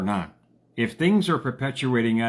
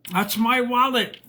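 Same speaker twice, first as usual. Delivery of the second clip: mock yell; 13 semitones higher, 3 dB louder, slower than usual. Lady yelling from her truck.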